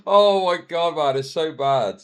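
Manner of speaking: ordinary delivery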